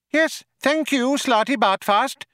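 very high-pitched, sharp little mouse. A narrator's reading. Mouse dismisses the old man, sharply. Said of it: "said Benji Mouse sharply".

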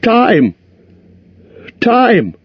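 high squealed words; panicked high squeak; sudden squeal. A narrator's reading. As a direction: let it burst out as a squeal. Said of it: "came out with a sudden squeal".

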